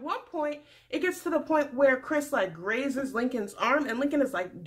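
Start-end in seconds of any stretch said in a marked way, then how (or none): none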